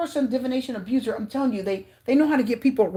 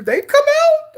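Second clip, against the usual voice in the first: much higher, louder burst; excited outburst; 7 dB louder, slower than usual. Real, not acted. Reader announcing both feared cards appeared, excited outburst. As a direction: blurt it in amazement.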